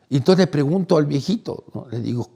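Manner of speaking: ordinary delivery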